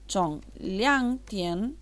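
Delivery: "Chong liang tian" drawn out word by word; very slow "Chong liang tian", each word apart; slow dictation. Shown as a demonstration. Teacher writes out from two o'clock, slow dictation of "Chong liang tian".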